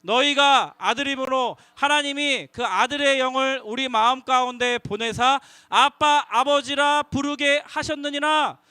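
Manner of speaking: 느리고 크게 함께 읽기; 한 글자씩 또박또박 읽어. Shown as a demonstration. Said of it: "같이 한번 읽겠습니다". (회중과 함께 천천히 읽는 성경 낭독)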